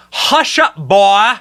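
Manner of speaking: loud, high mock-Southern drawl; exaggerated Southern drawl on a mocking quote; mimicked Southern drawl. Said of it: "in a mimicked Southern drawl".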